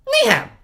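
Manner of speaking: very high, loud little shriek. A narrator's reading. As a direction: shriek it out like a small child. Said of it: "Sonny shrieked".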